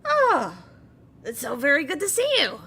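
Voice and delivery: high, loud, delighted goblin greeting; Bablano. Acted. Goblin greeting a visiting old friend, excited and loud. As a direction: greet them eagerly, high and quick. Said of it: "Bablano sees you and goes".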